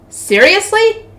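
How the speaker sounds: high-pitched disbelieving question; incredulous. A narrator's reading. Repeats the word in rising disbelief.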